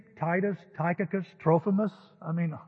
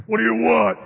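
higher, louder mock-gruff shout; gruff homeowner shout; 5 semitones higher, 11 dB louder than usual. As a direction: bark it like an annoyed homeowner at the door. Acting grumpy homeowner in practice.

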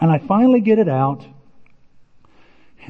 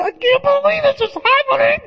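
much higher, squealing in disbelief; going crazy. Retold or imitated. Tim after the six hundred dollar glass explodes, high frantic squeal. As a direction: let it fly up like you're losing it. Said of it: "I went crazy".

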